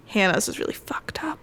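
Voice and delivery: hushed whisper. Real, not acted. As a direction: whisper it softly. Both drop to whisper.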